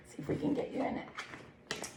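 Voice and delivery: whispered aside. Real, not acted. Reader whispering to herself while flipping pages, whispered. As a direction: breathe it under your breath.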